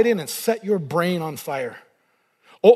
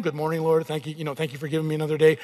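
quick, lower, rote morning prayer mumble; 4 semitones lower, 5 dB quieter, faster than usual. Retold or imitated. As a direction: rattle off a habitual prayer.

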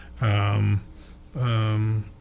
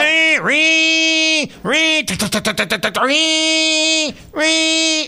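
mimicked phone ring; 10 semitones higher, much slower than usual. Mock phone ringing bit.

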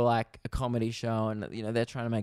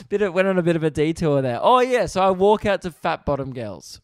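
louder, fast, still giggly; giddy; 6 semitones higher, 5 dB louder than usual. Real, not acted. Comic hurrying back to his story, still giggly.

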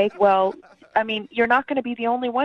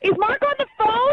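stunned; 9 semitones higher than usual. Real, not acted. Stunned caller asks about husband.

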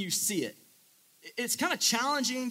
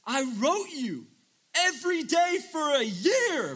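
mild mock shout; 7 semitones higher, 4 dB louder, slower than usual. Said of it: "Noah shouts back".

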